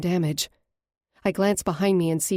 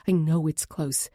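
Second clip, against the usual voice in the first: ordinary delivery, nothing marked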